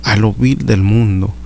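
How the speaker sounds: high, impassioned sermon delivery; fervent preaching. Acted. Preacher urging brothers to answer God's call, impassioned high preaching. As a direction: lift the congregation, preach with fervour. Preacher exhorting at full force.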